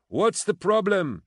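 high, drawn-out shout. A narrator's reading. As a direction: shout the question up a stairwell. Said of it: "she shouted".

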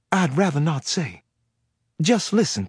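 a frightened woman with a Southern lilt; Southern accent. Acted. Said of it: "with a heavy Southern accent".

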